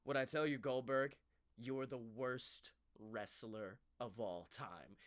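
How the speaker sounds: low, drawn-out mock taunt; mimicking a laid-back wrestler's put-down; imitated taunt. Imitating Riddle's video taunt.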